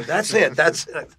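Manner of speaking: loud imitation of mom's recognition; retold high-energy confirmation; excited mother. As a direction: exclaim it as a delighted mock mom.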